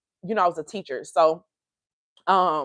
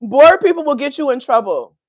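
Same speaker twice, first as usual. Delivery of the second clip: higher, louder, hammering it home; emphatic loud; 6 semitones higher, 7 dB louder than usual. Shown as a demonstration. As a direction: say it again with force. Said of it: "repeated after laugh".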